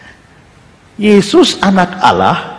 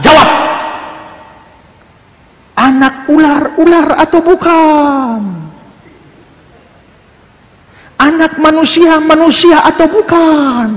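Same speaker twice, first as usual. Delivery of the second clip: barked demand; 9 semitones higher than usual. Retold or imitated. Speaker retells snapping at the silent audience, pressing. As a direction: snap "Jawab!" then press the questions slowly. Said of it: "saya bentak".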